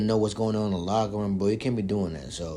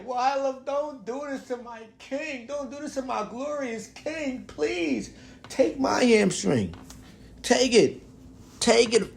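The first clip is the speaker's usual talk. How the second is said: LeBron fan begging fate to spare his king, mock anguish; high, hammed-up wailing prayer, offering a hamstring; mock anguished plea; 8 semitones higher, slower than usual. Theatrical lament in a much higher, strained voice.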